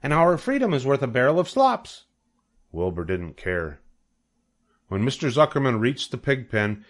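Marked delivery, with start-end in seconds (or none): 0.0-2.1 high goose voice; honking goose. A narrator's reading. The goose pleads with Wilbur to stay free, honking. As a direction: honk the warning out high. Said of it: "honked the goose".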